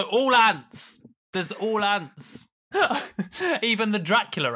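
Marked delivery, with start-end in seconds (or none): 0.0-2.5 slow drawn-out announcement. Acted.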